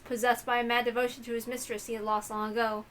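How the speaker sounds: ordinary delivery, nothing marked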